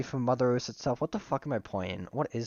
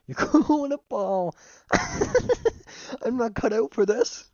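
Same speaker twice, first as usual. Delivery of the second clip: high, loud giggling through the words; laughing too hard to read; real laughter; 11 semitones higher, 4 dB louder than usual. Real, not acted. Curled up in a ball, reading while cracking up.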